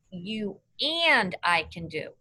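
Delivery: "and" drawn out as the key word; slow down and lean on "and"; stress on AND. Shown as a demonstration. Teacher repeating Hot Rod's words for pupils, stress on "and". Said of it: "he emphasized that word".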